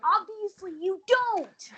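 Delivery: slow, high mock sarcasm; sarcastic retort. Snapping back at Naeem, slow sarcasm. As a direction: drag it out, dripping sarcasm. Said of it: "I'm like".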